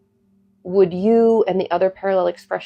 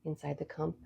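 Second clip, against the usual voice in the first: soft spa whisper; 11 dB quieter than usual. Acted. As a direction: lead them gently into calm. Leads a guided meditation.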